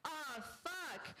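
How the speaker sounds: high, contained shout of pain; storyteller's restrained shout; acted shout. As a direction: cry out high without extra volume. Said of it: "and shouted".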